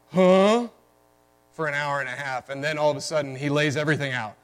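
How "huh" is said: confused huh. Retold or imitated. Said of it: "going through the story kind of".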